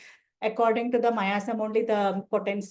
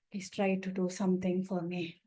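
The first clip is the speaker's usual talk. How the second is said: much softer, feeble plea; weak-chested begging; 4 semitones lower, 8 dB quieter than usual. Retold or imitated. Patient with low oxygen begging the doctor, feeble and soft. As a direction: barely get the words out, short of breath. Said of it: "The patient will be talking like that".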